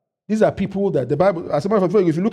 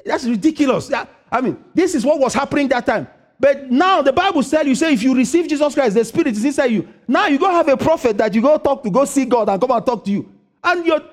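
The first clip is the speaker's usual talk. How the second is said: high, loud preacher's build; rising sermon fervour; full-force preaching; 10 semitones higher, 5 dB louder than usual. Acted. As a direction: drive the point home to the congregation.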